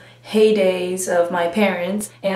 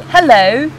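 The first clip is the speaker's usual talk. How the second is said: British accent; 3 semitones higher, 4 dB louder than usual. Shown as a demonstration.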